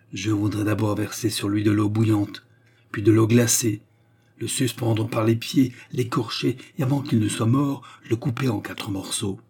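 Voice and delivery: plain reading voice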